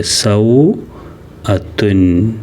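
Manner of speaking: സൗഅത്തുൻ drawn out very slowly with a rising end; teacher reading സൗഅത്തുൻ slowly aloud; slow model reading. Shown as a demonstration. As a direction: spell out സൗഅത്തുൻ slowly for the class. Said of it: "സൗഅത്തുൻ എന്നാണ് വായിക്കുക".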